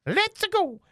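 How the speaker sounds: very high cartoony plumber voice; squeaky Italian plumber cheer; Mario voice. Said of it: "do Mario again".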